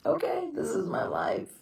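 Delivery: barely holding back tears, whispering; tearful whisper. Real, not acted. Widow resigning herself to her life, tearful whisper.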